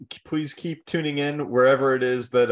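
ordinary talk, nothing marked

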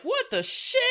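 high, startled mock outburst; shocked exclamation. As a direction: blurt it like you just saw something weird. Quoting Bacon seeing sheep.

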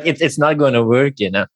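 ordinary talk

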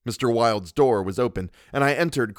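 plain reading voice

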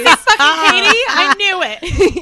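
high, loud gleeful gotcha; slow, gleeful I-knew-it tease; loud teasing gotcha; teasing accusation. Real, not acted. Teasing guess at friend.

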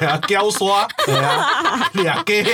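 giggling as he says it; laughing. Real, not acted. Host laughing while recalling he only caught chickens.

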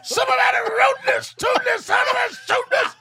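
high, loud cartoon cowboy yell; Yosemite Sam. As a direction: holler like a furious old prospector. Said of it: "Yosemite Sam is underrated".

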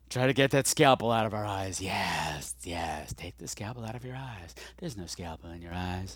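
calm the listener like a hypnotist; mock soothing.

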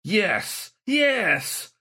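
high, triumphant gloat. A narrator's reading. Ghostly saint gloating over the cornered shaman. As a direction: savor his defeat. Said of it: "Galahad gloated".

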